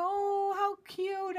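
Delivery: very high, loud cooing "oh"; gushing delight. Retold or imitated. Reacting to a friend's surprise package, high gushing delight.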